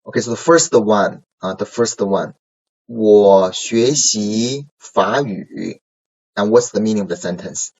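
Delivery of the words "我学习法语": 我学习法语 read slowly, syllable by syllable; slow model reading. Shown as a demonstration. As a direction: read it slowly for the class to repeat. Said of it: "let's read together these sentences".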